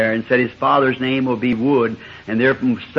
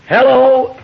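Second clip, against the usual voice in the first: high, loud friendly hello; welcoming call; 10 semitones higher, 6 dB louder than usual. Retold or imitated. Southern host greeting a visitor from the porch, loud welcoming call. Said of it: "they'd say".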